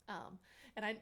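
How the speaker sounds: ordinary talk, nothing marked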